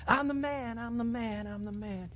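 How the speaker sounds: lightly voiced cocky boast, high at first then fading; mock Colin Kaepernick. Preacher mocks a headphone ad, lightly cocky. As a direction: toss off a swaggering brag. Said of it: "Colin Kaepernick with his Beats on".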